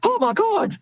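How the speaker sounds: "Oh my God." squealed very high in hammed-up alarm; mock panic. Shown as a demonstration. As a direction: show the doctor losing it, overdone. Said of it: "you can't go".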